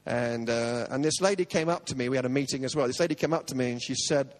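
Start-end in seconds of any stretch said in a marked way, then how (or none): none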